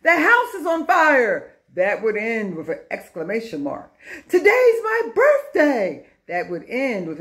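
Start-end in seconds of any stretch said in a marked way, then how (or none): 0.0-1.5 higher, louder alarmed exclamation; excited exclamation. Shown as a demonstration. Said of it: "That means it shows excitement". 4.3-6.1 high, loud, thrilled announcement; excited exclamation. Shown as a demonstration. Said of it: "That means it shows excitement".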